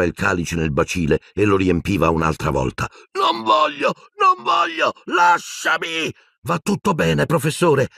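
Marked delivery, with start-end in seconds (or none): 3.1-6.1 terrified shriek. A narrator's reading. Wizard screams under potion. 6.4-7.9 high, trembling attempt to soothe; a frightened boy reassures his teacher, voice trembling; shaky reassurance. A narrator's reading. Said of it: "con la mano che tremava".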